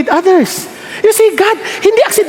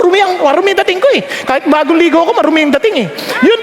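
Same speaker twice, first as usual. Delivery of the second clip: ordinary delivery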